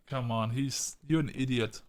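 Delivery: usual speaking voice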